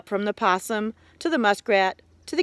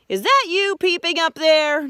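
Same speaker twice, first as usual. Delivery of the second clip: demanding Possum; 9 semitones higher, 4 dB louder than usual. Acted. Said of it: "demanded Possum".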